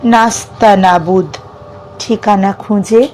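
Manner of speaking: measured verse reading with long pauses between lines; poem recitation. Acted. Reciting a self-written poem about dreams to listeners, measured poetic delivery. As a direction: recite it phrase by phrase, lifting some line endings, letting others fall.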